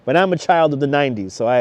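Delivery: ordinary delivery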